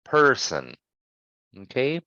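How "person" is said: "person" with a full, held er, falling after; emphasis on er. Shown as a demonstration. Teacher demonstrating emphasis for students, "person" with strong er. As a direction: put your weight on the er in "person". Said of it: "putting more emphasis on the er".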